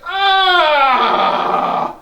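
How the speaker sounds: loud mock cry of pain; short laughing mock scream. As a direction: let out a hammed-up yell. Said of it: "you're just like".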